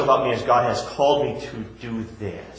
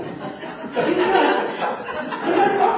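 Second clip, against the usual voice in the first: high laugh. Real, not acted. Pastor laughs at own impression.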